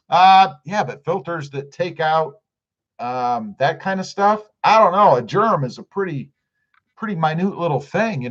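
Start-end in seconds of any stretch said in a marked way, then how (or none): none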